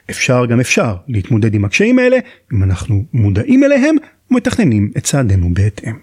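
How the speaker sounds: ordinary talk, nothing marked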